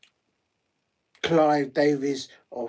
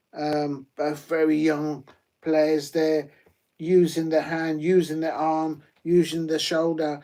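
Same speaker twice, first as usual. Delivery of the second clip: ordinary talk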